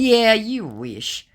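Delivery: high, slow, smug taunt; slow sarcastic comeback. Retold or imitated. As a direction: drag it out to mock him. Her own taunting reply.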